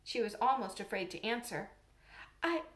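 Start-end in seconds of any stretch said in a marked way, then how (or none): 2.4-2.6 shy hesitant stammer, higher. Acted. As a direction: falter over the words nervously. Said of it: "she stammered".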